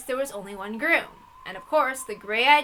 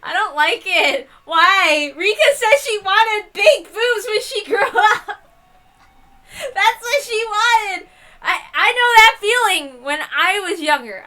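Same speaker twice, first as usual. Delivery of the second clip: laughing complaint; 9 semitones higher, 8 dB louder than usual. Real, not acted. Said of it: "I'm sorry I have to say that".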